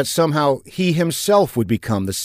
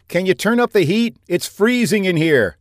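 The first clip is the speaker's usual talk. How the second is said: higher, raised voice through a door; calling out; 9 semitones higher, 3 dB louder than usual. A narrator's reading. Freezing captive to pilot beyond the doors, calling out. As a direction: call out a complaint to someone in the next room.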